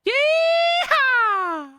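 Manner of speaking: screaming high hillbilly whoop; over-the-top cowboy holler; country yeehaw. Pretend. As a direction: yell it like a rodeo cowboy gone wild. Hamming up the fake accent.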